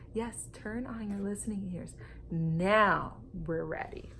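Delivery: ordinary delivery, nothing marked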